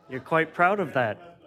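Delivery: laughing playful jab; laughing tease. Real, not acted. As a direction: tease him while still laughing. Chair teasing while laughing.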